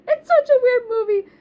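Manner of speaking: loud, high-pitched exclamation; baffled outburst. Real, not acted. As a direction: blurt it out high and loud, bewildered. Exasperated outburst about odd plot.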